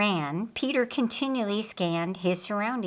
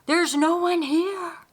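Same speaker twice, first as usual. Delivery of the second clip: muffled; 10 semitones higher, 4 dB louder than usual. Acted. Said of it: "a muffled response from inside".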